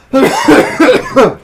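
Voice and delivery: loud hacking coughs; coughing fit. Real, not acted. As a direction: cough hard as if choking. Choking on peanuts.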